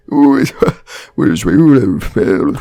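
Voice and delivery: loud, slow garble; garbled mate. Retold or imitated. Mate questioning Barry, loud and slurred. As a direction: slur it like a mate.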